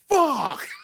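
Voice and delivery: very high, loud yelp of a curse; shouted curse. Retold or imitated. Someone blown up in the scene, high yelled curse. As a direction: blurt it out in a shrill outburst. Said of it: "you hear something like".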